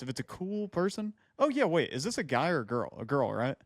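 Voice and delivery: ordinary delivery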